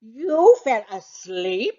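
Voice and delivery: can't believe what he hears; incredulous. Acted. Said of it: "I asked incredulously".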